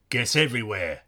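Cockney market man, offhand; strong Cockney accent. A narrator's reading. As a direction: toss it off in thick Cockney. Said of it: "in a strong Cockney accent".

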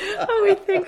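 high, loud laughter; laughing. Real, not acted. Wife laughing retelling James's mix-up, laughing.

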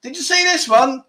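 high, cocky taunting after each shot; gloating winner. Pretend. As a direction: rub it in like a smug winner. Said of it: "I'm gonna be giving it".

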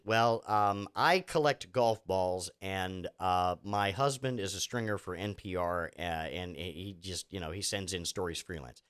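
low, deadpan, as the guest naming the budget; mock TV guest. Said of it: "And what's the budget?".

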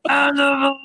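loud high yell. Real, not acted. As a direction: belt it out. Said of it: "stop yelling animals".

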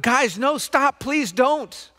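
high, slow, pleading cry; begging for my life. Retold or imitated. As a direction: plead desperately for mercy. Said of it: "Joseph was actually begging for his life".

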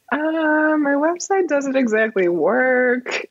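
drawn-out, high-pitched awkward admission; sheepish confession. Retold or imitated. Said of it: "you made this confession".

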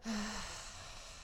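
long, hushed breath out; soft sigh. Pretend. Leader breathing out after the shrinking, soft sigh. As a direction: exhale softly and slowly.

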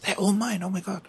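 high, hushed, excited little voice; imitating gassed child. Dad imitating his son seeing twelve presents, excited. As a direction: squeal it softly, like a thrilled kid. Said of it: "He was so gassed".

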